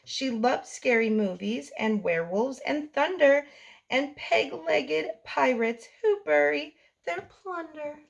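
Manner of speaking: plain reading voice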